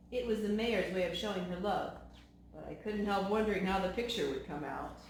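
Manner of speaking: plain reading voice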